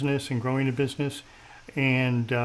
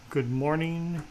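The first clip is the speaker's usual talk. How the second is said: ordinary talk, nothing marked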